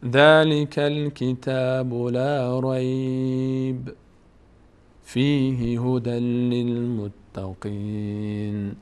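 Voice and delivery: chanted slowly, with a clear stop after رَيْبَ before فِيهِ; recite, stopping on رَيْبَ; slow recitation with a pause after رَيْبَ. Shown as a demonstration.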